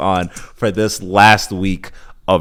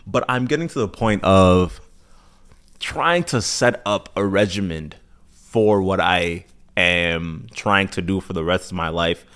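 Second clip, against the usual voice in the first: usual speaking voice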